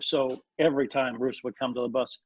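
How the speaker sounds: normal talking voice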